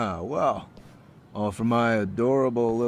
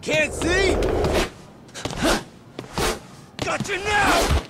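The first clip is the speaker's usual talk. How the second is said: much higher, louder triumphant yell; gloating shout; 6 dB louder, slower than usual. Acted. Gang leader shouting at the blinded fighter. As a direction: crow it as you strike.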